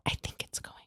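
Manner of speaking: hushed bathroom whisper. Retold or imitated. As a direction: whisper it as if hiding.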